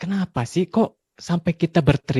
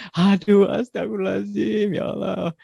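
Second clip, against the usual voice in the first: embarrassed gasp; 6 semitones higher than usual. Retold or imitated. Father embarrassed by child's remark.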